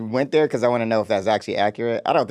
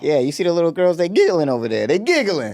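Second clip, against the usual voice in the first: higher-pitched, worked-up complaining; mock agitation; 6 semitones higher than usual. Acting out Jenny to the co-host, mock agitated. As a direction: play it agitated, pitch climbing as you repeat.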